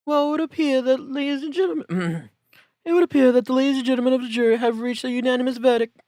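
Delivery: judge voice. Acted. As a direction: announce it like a fussy courtroom judge. Judge announces verdict.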